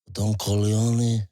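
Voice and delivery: husky mafia don; Marlon Brando impression. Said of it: "Marlon Brando, The Godfather".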